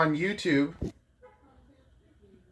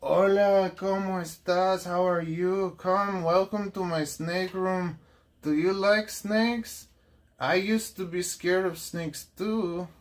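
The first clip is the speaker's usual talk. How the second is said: hammed-up Spanish accent, slow and a little higher; 3 semitones higher, 4 dB quieter than usual. A jokey Spanish accent to show viewers the snake room. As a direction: play a welcoming host with a mock Spanish accent. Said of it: "I'm allowed to do Spanish accents like".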